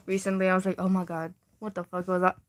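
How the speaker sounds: normal talking voice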